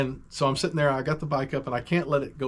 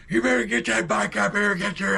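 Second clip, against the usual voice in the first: high, loud angry father; yelling dad; 9 semitones higher, 4 dB louder than usual. Retold or imitated. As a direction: bark it like a furious dad. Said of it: "I hear my dad go".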